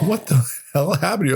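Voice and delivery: high mock-incredulous question; lightly voiced mocking family; family laughing at him. Mother and sister asking what happened, high mock disbelief. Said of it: "They're like".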